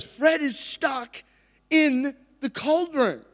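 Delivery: high, very drawn-out comic cry; mock alarmed announcement. Friend Grant telling the manager about trapped mate, slow mock alarm. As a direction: stretch out each word in exaggerated alarm. Friend reports the emergency, retold.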